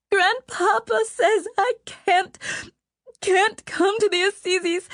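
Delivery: high, tearful wail. Acted. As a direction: wail like a crying girl. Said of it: "she wailed".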